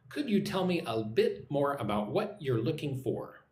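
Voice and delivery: measured, carefully spaced question; repeat-after-me model. Shown as a demonstration. Teacher giving learners a question to repeat, measured and careful. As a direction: model the question unhurriedly, word by word. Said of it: "Listen and repeat".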